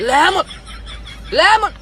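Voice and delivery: লেমন heaved out high and loud; explosive, high demonstration of লেমন; sound forced from inside. Shown as a demonstration. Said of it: "তোর ভিতর দিকে sound আনার লাগে".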